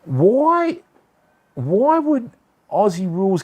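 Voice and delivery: can't believe it, voice leaps up; indignant surprise. Real, not acted. Streamer protesting that a viewer got moderated, indignant surprise. Viewer's comment deleted by Twitch.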